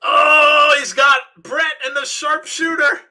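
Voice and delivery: high, loud commentator shout; shouted play-by-play outburst; excited match call. Real, not acted. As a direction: erupt as the submission hold locks in. Calls a big hold in match.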